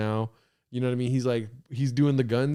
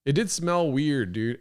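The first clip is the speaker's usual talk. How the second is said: ordinary delivery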